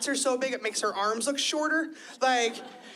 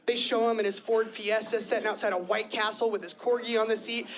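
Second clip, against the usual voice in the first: normal talking voice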